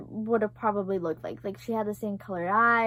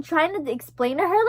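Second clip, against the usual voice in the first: usual speaking voice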